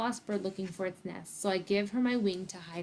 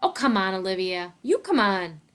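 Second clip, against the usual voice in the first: louder, punchy comeback in a quarrel; exasperated retort; 9 dB louder than usual. A narrator's reading. Justin quarrels with his friend Olivia, exasperated. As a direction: throw it back at a friend, exasperated.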